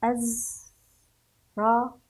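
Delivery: أزرق split into az, ra, qu; أزرق in separate syllables, long pauses between; spelled out. Shown as a demonstration. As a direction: sound out أزرق very slowly with a held z. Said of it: "نتهجى الأحرف".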